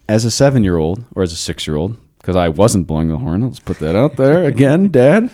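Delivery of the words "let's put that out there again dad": high, rising jab at dad; mock-indignant, breaking into a laugh; playful grievance. Real, not acted. Teasing his dad via podcast.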